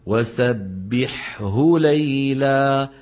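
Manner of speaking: وسبحه with the حاء and هاء both sounded, slow; clear ha and ha. Shown as a demonstration. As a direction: draw out وسبحه ليلا, every letter clear. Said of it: "الحاء شيء والهاء شيء". (نطق واضح للحاء والهاء)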